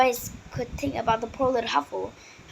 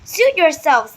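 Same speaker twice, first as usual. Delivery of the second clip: louder, higher, sulky retort; snapped; 5 semitones higher, 10 dB louder, slower than usual. A narrator's reading. Izumi snapping at Grace for leaving, higher and louder. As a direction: snap it back huffily. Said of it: "snapped Izumi".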